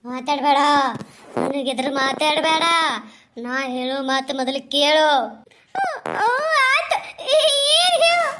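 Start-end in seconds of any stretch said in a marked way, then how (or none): 0.1-5.1 a lightly voiced menacing ghost. Ghost commands.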